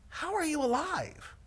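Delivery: high-pitched disbelieving question; imitating an amazed rescuer; astonished. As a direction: marvel in sheer disbelief. Astonished paramedic retold.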